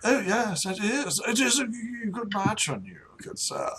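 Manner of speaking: high, soft noble lady; softly compliment a stranger's looks; approving duchess. Acted. Game master plays the duchess.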